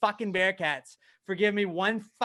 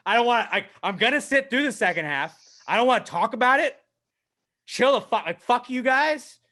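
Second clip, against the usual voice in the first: raised, louder retold grumbling; sulking dad anger; 7 semitones higher, 5 dB louder than usual. Fan fed up with his team, acted angry. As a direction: act out a fed-up, disgusted fan. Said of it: "I felt like that dad".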